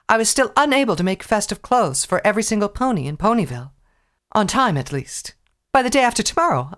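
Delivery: theatrical elegant mare; posh lady voice. Acted. Rarity voiced by reader.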